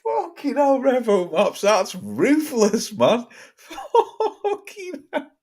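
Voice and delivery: high-pitched, laughing disbelief; shocked laughter. Real, not acted. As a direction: talk through real laughter, stretching words out. Cracking up at a ruthless joke.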